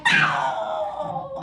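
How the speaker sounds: a high, far-off screech in place of the word; owl screech. Acted. Owl swooping over a pioneer farmer, high shriek.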